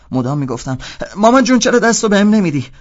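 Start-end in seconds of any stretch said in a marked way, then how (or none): 1.2-2.7 very high, loud wail to mummy; mock blind pleading. Playing blind to annoy the roommate, loud mock pleading. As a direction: beg like a hammed-up lost child. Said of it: "داشتم مسخره بازی در میآوردم".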